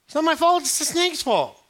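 high, loud, whiny excuse; whining Eve. Acted. Eve whining to God that the snake did it, high and loud. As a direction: pass it to the snake.

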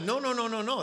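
high, loud string of no's; rapid-fire insistent no-no-no; emphatic refusal. Acted. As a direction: cut the objection off, loud and high. Acted cutting off of objection.

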